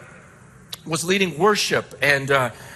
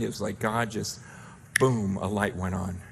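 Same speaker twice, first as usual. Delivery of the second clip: ordinary delivery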